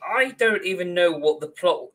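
ordinary talk

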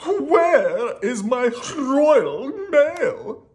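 high, loud, very slow royal demand; hammed-up impatient storybook monarch; demanding king. A narrator's reading. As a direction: stretch every word in kingly indignation. Impatient king demands his mail.

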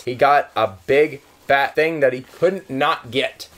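normal talking voice

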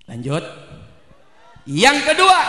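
normal talking voice

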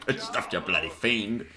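mock nineteen-fifties English accent; old-era accent. Gamer repeating his joke insult, mock fifties accent. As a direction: lightly put on an old-time English accent. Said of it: "What era was that from?".

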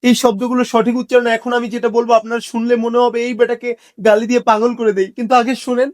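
ordinary talk, nothing marked

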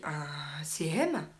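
whispered puzzled question, low and slow. Real, not acted. Streamer whispering to herself, puzzled by a viewer's term. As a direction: mutter it to yourself, baffled.

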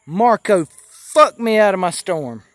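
slow, loud furious yell at Marco; angry shout. Acted. Roofer curses Marco for stealing his storm, loud angry yell. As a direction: bellow it out, drawn out and raging.

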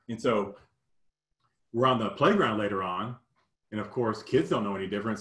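usual speaking voice

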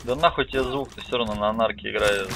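ordinary talk, nothing marked

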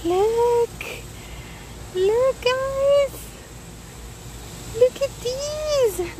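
excited. Real, not acted. Excited harvest reveal.

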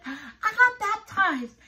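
high, loud, bursting with joy; excited girl. Retold or imitated. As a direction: retell her thrilled announcement, beaming. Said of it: "And she was so excited".

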